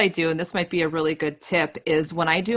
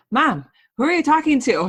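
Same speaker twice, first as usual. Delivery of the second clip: put-on high, louder kid's voice asking mom; imitating her kids; 10 semitones higher, 5 dB louder, faster than usual. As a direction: mimic a puzzled child, pitched up. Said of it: "they're always like".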